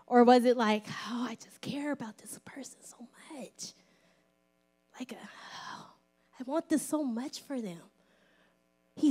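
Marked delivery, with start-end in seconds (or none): 1.1-7.8 hushed, whispery sigh of longing; caring sigh. Acted. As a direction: sigh it softly with deep care. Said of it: "what that sigh was for".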